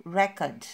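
record said REkord, first syllable loud, schwa after; stress on REC. Shown as a demonstration. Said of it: "ini dibacanya e, ya, schwa sound".

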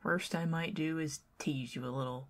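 normal talking voice

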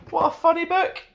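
high amused exclamation; delighted. Real, not acted. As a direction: gush about how funny it was. Reacting after reading.

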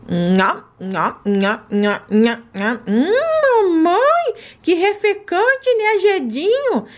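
nham nham eating then high delight; high, slow pet savoring; munching pet. Acted. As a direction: chew noisily like a happy puppy. Pet eats treat.

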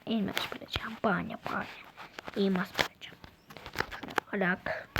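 whispered to himself, hushed. Real, not acted. Kid whispering to himself about finding diamonds, hushed. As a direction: murmur the wish under your breath.

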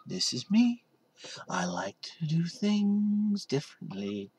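mock the phony self-presentation; fake facade. Mocking people who put on a fake front, softly. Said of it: "put on a facade".

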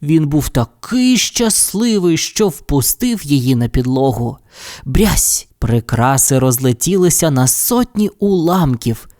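ordinary delivery, nothing marked

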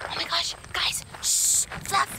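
excited, urgent whisper; hushed. Real, not acted. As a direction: whisper so as not to scare the animal off. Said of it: "we gotta be quiet".

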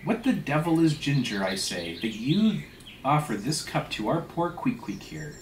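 scornful, high-pitched questioning; indignant. Acted. Stubb berating the steward over ginger, indignant. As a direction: fire off incredulous questions, punching the key words.

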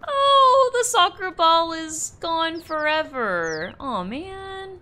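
mock wail. Player mourning a lost soccer ball, hammed-up wail. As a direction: wail over it theatrically.

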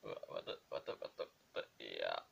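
breathy under-the-breath whisper. Real, not acted. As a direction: murmur it to yourself. Quiet muttering while opening.

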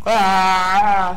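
loud, high, hammy fake scream. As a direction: shriek it, badly faked. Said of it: "the worst fake scream I've ever heard".

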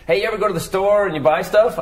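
put-on hacky old comic. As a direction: pitch it like a cheesy stand-up opener. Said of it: "I would just do stuff like".

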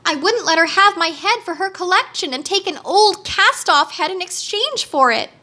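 high-pitched indignant explanation; exclaiming. A narrator's reading. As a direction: exclaim it as an aggrieved girl. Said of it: "exclaimed Dorothy".

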